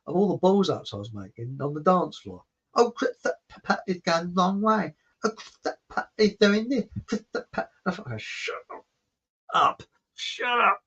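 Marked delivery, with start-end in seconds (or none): 2.7-7.7 "Christopher" with slushy, mangled lisped consonants; mock lisp, sputtering on "Christopher"; lisping commentary. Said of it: "a friend of hers that spoke with a lisp". 8.1-10.8 drawn-out mock-seething shut up; imitated suppressed irritation; muttered exasperation. His inward retort to the commentary.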